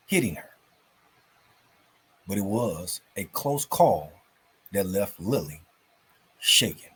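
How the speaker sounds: put-on lively storybook reading for kids; story narration. A narrator's reading. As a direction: tell it like a bedtime story, bright and animated. Reads story to children.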